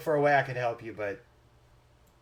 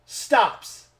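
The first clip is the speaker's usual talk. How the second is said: raised, building storytelling with pauses; dramatic game master narration; 3 semitones higher, 4 dB louder than usual. A narrator's reading. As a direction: narrate a mock tragedy, swelling to the end. Game master narrates the deer's death.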